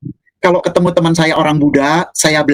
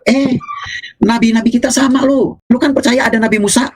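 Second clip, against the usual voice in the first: eager persuading friend; 8 semitones higher than usual. Retold or imitated.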